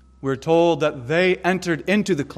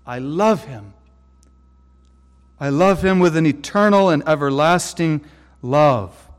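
God's loving declaration; 5 dB louder than usual. Acted. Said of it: "God said".

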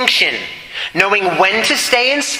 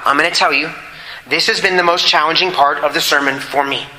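ordinary delivery

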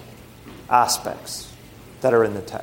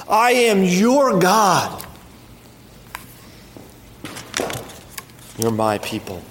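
tender divine promise; 3 semitones higher than usual. Acted. Speaking as God.

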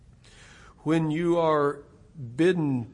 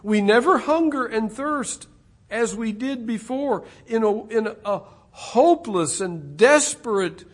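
higher and louder preaching; rising preaching; 7 semitones higher, 8 dB louder than usual. Real, not acted. Preacher rising in fervour toward the close. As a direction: lift it again toward the close.

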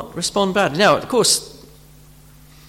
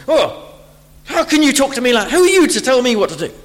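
higher, louder and fast, indignant; abrasive proud retort; 8 semitones higher, 5 dB louder, faster than usual. Retold or imitated. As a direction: bristle at being corrected, quick and sharp. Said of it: "this sort of abrasive, uh, reaction".

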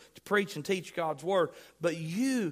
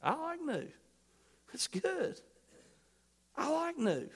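mock childlike voice; 8 semitones higher, 7 dB quieter than usual. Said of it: "How many of you like new things?".

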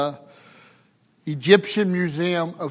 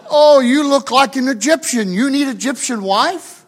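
lightly imitated vendor, pitched up and louder; a hint of a woman making her sales pitch; Egyptian vendor woman; 5 semitones higher, 5 dB louder than usual. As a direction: play the flattering street seller, voice lifted. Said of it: "Egyptian woman, older woman".

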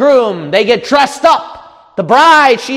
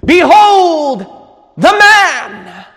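ringing, raised announcement to the world; Pilate's proclamation; 6 semitones higher, 3 dB louder, much slower than usual. Acted. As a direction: call it out high and stately. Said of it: "Pilate introduced the king to the world".

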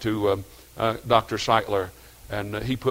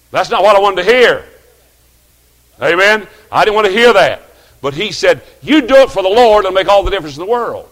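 loud, higher, climbing preacher cry; full-force pulpit delivery; preaching at full force; 9 semitones higher, 13 dB louder than usual. Acted. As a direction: preach the discouragement out to the pews.

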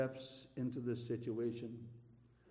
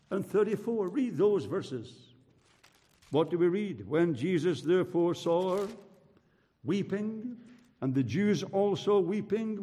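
raised, louder and higher pulpit delivery; forceful preaching; 5 semitones higher, 6 dB louder than usual. Real, not acted. Preacher takes congregation through the troubling verses, forceful preaching.

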